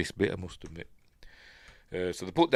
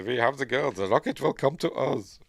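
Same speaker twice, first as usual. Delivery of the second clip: light mock German accent on the villain's line; hint of a German accent, a bit higher; playful imitation of a German accent; 4 semitones higher than usual. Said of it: "He keeps putting on a German accent".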